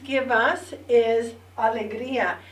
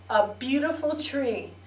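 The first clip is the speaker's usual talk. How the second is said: ordinary delivery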